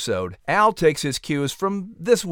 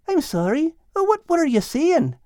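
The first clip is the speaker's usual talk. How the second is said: higher put-on voice, mouth full; Al's voice; 13 semitones higher than usual. A narrator's reading. Narrator voicing Al, confused while chewing. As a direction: fumble the words like a distracted cat.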